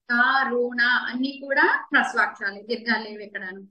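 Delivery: ordinary delivery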